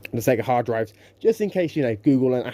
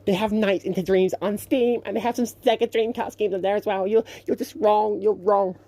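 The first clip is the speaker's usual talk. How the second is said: mock know-it-all commenter; 8 semitones higher than usual. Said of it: "I hear some people asking".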